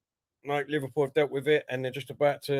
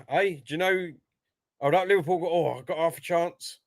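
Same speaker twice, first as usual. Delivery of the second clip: ordinary talk, nothing marked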